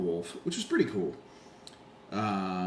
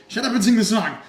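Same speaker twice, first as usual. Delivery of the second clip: high, loud impatient shout; mock-annoyed concertgoer shouting; exasperated heckle; 11 semitones higher, 11 dB louder than usual. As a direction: yell at the singer to get on with it. Retold heckle of singer.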